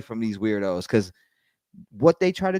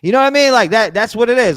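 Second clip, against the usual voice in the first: much higher and louder punchline; emphatic exclaim; 11 semitones higher, 8 dB louder than usual. Real, not acted. Pressing his point to the chat, loud. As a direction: shout it home to the chat.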